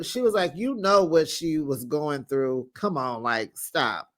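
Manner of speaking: ordinary talk, nothing marked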